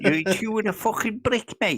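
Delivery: high, laughing Scouse impression; a hint of a Scouser; Scouse accent.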